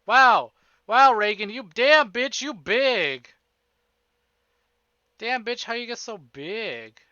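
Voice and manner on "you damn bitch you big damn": ordinary talk, nothing marked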